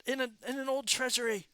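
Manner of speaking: high, loud flustered protest; rattled reply. Acted. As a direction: blurt it out high, as if grabbed by the collar. Grabbed NPC answers a screamed demand.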